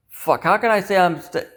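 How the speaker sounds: ordinary talk, nothing marked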